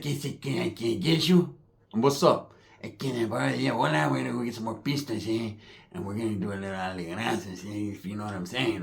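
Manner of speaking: hushed secretive cousin. Retold or imitated. Cousin asking to borrow him, hushed and sneaky. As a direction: murmur it like a secret. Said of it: "my primo's like".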